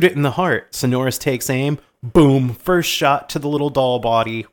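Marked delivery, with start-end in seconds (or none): none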